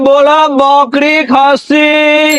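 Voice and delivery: high, slow, drawn-out street vendor cry; hawker's call. Acted. Goat buyer hawking door to door, long drawn-out sing-song call. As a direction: stretch every word like a village goat buyer calling out.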